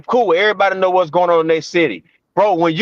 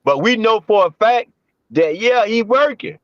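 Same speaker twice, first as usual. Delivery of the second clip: usual speaking voice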